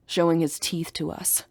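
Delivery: ordinary delivery, nothing marked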